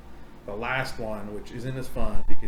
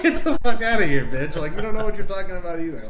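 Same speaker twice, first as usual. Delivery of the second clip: higher, louder, fast brush-off; higher, louder, fast scoffing dismissal; 5 semitones higher, 6 dB louder, faster than usual. Retold or imitated. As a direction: shout him down. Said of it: "It's like".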